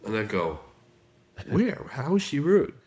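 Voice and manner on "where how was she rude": high, incredulous "where?"; puzzled, can't see what she means; baffled question. Retold or imitated. Husband baffled by wife's complaint.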